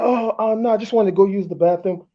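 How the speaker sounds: high, flustered mock girl voice; caught girlfriend. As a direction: act her caught off guard and denying.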